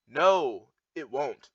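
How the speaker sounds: ordinary delivery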